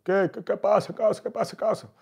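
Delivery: high, startled what's-going-on. Retold or imitated. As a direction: jump up in pitch, alarmed.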